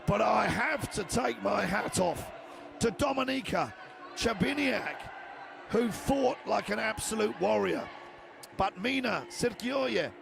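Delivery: energetic live fight call; play-by-play commentary. Real, not acted.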